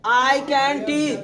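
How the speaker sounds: very slow and deliberate; slow model. Shown as a demonstration. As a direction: lay each word out slowly. (बहुत धीरे धीरे बोलो)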